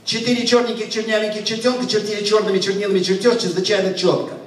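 very fast, run-together recitation; rapid tongue twister. Shown as a demonstration. Performer showing the audience a Russian tongue twister, rattled off fast. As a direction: rattle it off at speed in one go. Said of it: "Next tongue twister about four boys".

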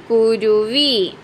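കുരുവി read as one word, drawn out very slowly; slowly blended. Shown as a demonstration. Teacher blending the syllables into a word for children. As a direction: join the syllables into കുരുവി, stretched long. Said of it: "എന്ത് വായിക്കാം കൂട്ടിയിട്ട്?".

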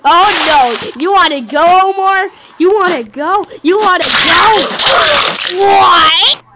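loud, high taunt, spoiling for a fight; hyped challenge. Real, not acted. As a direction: call him out, loud and pumped. Challenging friend Omar to fight.

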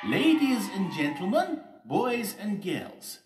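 very high, loud ceremonial voice; mayor's announcement. A narrator's reading. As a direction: address the crowd grandly, stretching the ending. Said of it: "said the mayor, stepping up to the microphone".

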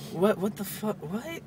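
high, stammering bafflement; incredulous squeak upward at the end; stunned disbelief. Acted. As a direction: act dumbfounded, pitch leaping on the last word. Joke character stunned by jumper.

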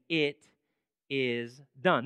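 measured, weighty declaration; solemn divine pronouncement. Acted. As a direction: let each word land on its own. Voicing God's declaration, repeated.

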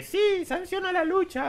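high-pitched mock squeaky voice; thin little voice. Reviewer mimicking the unconscious wrestler's faked answer, thin high voice. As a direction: imitate a silly, tiny high voice. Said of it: "por una voz finita".

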